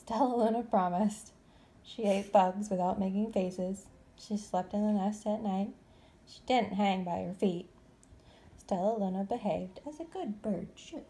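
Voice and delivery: plain reading voice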